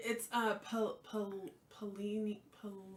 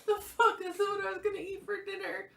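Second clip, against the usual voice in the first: shocked outburst; 11 semitones higher, 4 dB louder than usual. Real, not acted. Reacting to food on plate.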